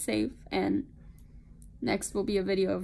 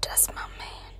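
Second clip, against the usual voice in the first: whispering. Real, not acted. Whispered aside while filming.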